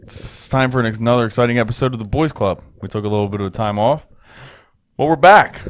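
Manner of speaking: loud hype host opener; booming show intro. Acted. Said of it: "You usually do the intro".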